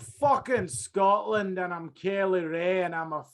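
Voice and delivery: hammed-up Scottish brag; mock Scottish accent. Cohost mimics Kaylee Ray in a Scottish accent. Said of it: "speaking in that sexy Scottish accent".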